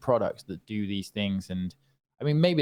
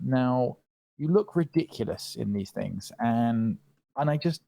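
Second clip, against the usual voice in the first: ordinary talk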